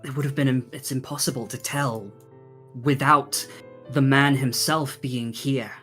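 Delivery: ordinary delivery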